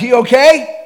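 high, loud anxious question; worried wife. Retold or imitated. Wife answering his call after the shooting, high anxious voice. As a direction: ask it like a scared wife.

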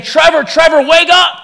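urgent waking call. Retold or imitated. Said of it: "shaking me awake".

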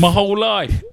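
high echo in a fake English accent; mock-gush in a bad English accent. Friend echoing the fan, bad English accent. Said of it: "That was a shitty accent".

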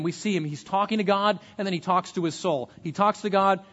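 ordinary delivery

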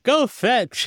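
high-pitched called-out order; mock command. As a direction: call it out like sending a dog after a ball. Voicing a character kicking a ball away.

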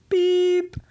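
high, loud censor beep; mock beep. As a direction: beep like a bleep tone.

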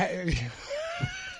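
high squealing laugh; real cackle; shrieking laugh. Real, not acted. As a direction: burst into a shrill laugh. Big laugh at story.

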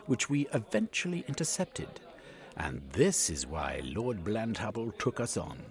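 high, gloating explainer; smug Yegman. A narrator's reading. Yegman boasts to Cornucope of the intercepted messages. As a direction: gloat over how you fooled them.

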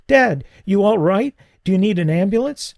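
high, alarmed plea; a frightened boy begging his father to answer; panicked son. Acted. Son asks his choking father about an ambulance, high and alarmed.

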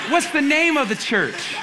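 imitating the coach pressing for the church's name; mock eager coach. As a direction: ham up the coach's question. Coach asking eagerly, mimicked.

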